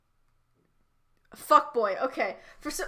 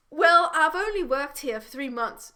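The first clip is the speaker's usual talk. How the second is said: attempted British accent, a bit louder; 3 semitones higher, 5 dB louder than usual. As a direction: read Holly in an amateur British accent. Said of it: "in my British accent".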